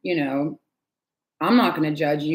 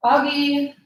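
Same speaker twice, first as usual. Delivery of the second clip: high call across the house to the cat; 9 semitones higher, 3 dB louder than usual. Real, not acted. Calling her cat from the other room, high shout. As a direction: call the cat's name loud and high.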